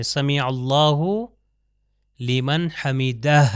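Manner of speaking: سمع اللہ لمن حمیدہ drawn out, ع in سمع and ہ at the end clear; slow careful Arabic recitation. Shown as a demonstration. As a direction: recite slowly, sound the ع and the final ہ. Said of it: "آخر میں وہی اسی طرح ہے".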